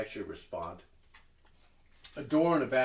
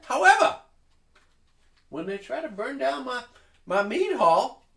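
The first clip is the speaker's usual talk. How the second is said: much higher and much louder, theatrical indignation; grand halfling hostess; 12 dB louder than usual. Acted. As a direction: sweep in and scold like a diva expecting applause. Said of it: "walks like someone expecting applause".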